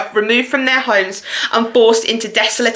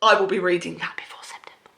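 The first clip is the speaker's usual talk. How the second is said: whisper; 4 semitones lower, 8 dB quieter, faster than usual. Real, not acted. Secret vow to viewers.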